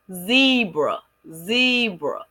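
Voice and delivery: "zebra" drawn out as ZEE-bruh; syllable demonstration. Shown as a demonstration. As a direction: stretch the first syllable of "zebra", then drop on bruh. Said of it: "the E and the B".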